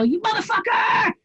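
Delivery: high, furious mock shout at a clueless driver; road-rage yell. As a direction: yell it like someone just cut you off. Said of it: "and I go".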